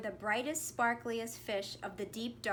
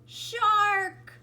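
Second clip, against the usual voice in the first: higher, louder, stretched out; dramatic reveal; 9 semitones higher, 10 dB louder than usual. A narrator's reading. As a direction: land the scary word big.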